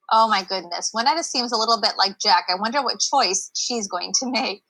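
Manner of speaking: usual speaking voice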